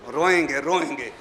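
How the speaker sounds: low, soft, falling words; quiet grief. Acted. Husain tells his sister softly, low quiet grief. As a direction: let it sink, barely voiced grief.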